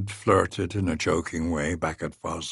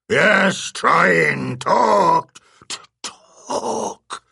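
halting creature voice; 10 semitones higher, 5 dB louder, much slower than usual. A narrator's reading. Beast struggling to speak.

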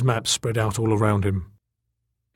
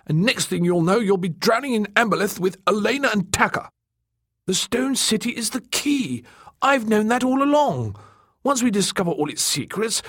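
high, indignant character voice; fussy, stubborn mapmaker; 12 semitones higher than usual. Acted. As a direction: insist in a high, agitated voice.

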